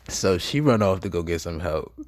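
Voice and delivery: ordinary delivery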